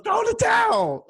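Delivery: high, loud drawn-out shout; Rocky movie cry. Acted. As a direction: holler it from ringside, hammed up. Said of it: "He goes".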